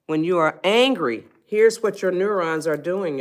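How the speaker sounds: ordinary talk, nothing marked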